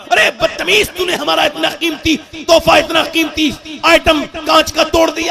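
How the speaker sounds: high-pitched, outraged telling-off; angry scolding mother. Acted. Mother scolds child over broken glass gift, angry.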